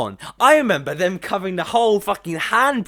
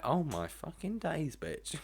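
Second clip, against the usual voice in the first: hushed aside; 14 dB quieter, slower than usual. Retold or imitated. Muttered reaction to toxic gossip.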